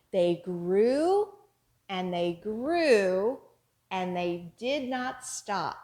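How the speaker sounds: action rhyme. Shown as a demonstration. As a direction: chant it out for little ones. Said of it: "as we say our finger play".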